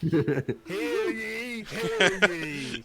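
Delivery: loud, high hammed-up herald's call, slow and laughing; town crier. A Divinity fan quotes the game's herald, hammed-up loud crier. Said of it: "Divinity fans".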